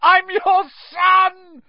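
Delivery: drawn-out, very high desperate scream. A narrator's reading. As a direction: scream it up at your father. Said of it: "he screamed up at Crouch".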